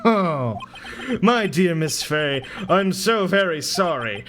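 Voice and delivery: slow, theatrical mock-regretful villain; menacing villain. Villain bidding Miss Fey farewell, slow mock-sorry menace. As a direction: draw out every word of the threat after a laugh. Villain threatens his victim.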